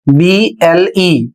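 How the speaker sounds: ordinary talk, nothing marked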